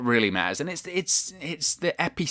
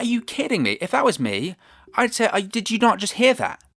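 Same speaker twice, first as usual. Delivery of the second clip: high, disbelieving rant; my head just exploded at this; incredulous, voice way up; exasperated outburst; 11 semitones higher, 3 dB louder than usual. Real, not acted. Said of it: "my head exploded".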